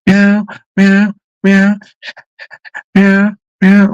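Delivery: loud, high, wailing imitated meows; imitating pained meows. Retelling finding the injured cat, imitating her loud meows. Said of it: "and she's going".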